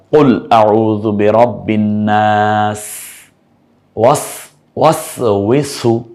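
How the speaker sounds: slow chanted Quran recitation. Shown as a demonstration. Teacher reciting verse to test students. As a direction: recite slowly in tajweed style. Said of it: "কি দিয়ে বলেছি বলুন তো".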